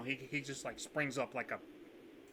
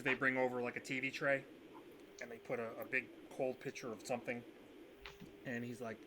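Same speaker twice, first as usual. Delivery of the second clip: ordinary talk, nothing marked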